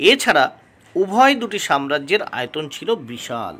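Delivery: ordinary delivery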